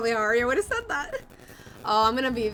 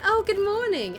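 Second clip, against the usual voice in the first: chirpy greeting; 10 semitones higher, 4 dB louder than usual. Retold or imitated. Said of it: "she went".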